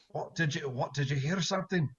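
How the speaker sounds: hushed, half-held booming voice; a big voice trying to keep it quiet; quietest booming voice. Acted. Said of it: "in his quietest booming voice he can".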